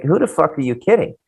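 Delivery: usual speaking voice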